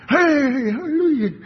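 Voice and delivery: high, loud hammed-up hallelujah; mock cheery greeting. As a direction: overdo the bubbly churchy hello. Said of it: "how many of us are gonna go".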